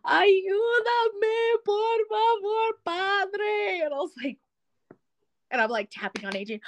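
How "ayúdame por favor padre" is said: high, sing-song wailing plea; imitating a sleep-talker. Mother-in-law pleading in her sleep, high sing-song wail. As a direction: wail it out like a night terror, high and slow. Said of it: "And she goes".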